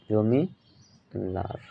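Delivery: জমিলার drawn out very slowly, broken before লার; slow dictation. Real, not acted. Teacher repeating the answer জমিলার to students, very slowly. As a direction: say জমিলার in two slow parts, জমি, then লার.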